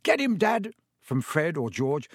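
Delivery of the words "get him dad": high-pitched excited cheer-on; yell. A narrator's reading. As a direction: yell it like a boy egging Dad on. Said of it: "There was a yell of".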